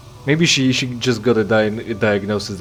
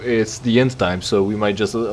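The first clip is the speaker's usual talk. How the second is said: ordinary talk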